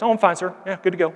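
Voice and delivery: a hint of a nervous young airman brushing it off; mock-casual "I'm fine, sir"; voicing young airman.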